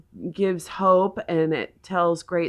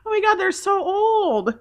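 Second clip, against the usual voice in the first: amazed exclamation; 14 semitones higher than usual. Retold or imitated. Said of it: "I was like".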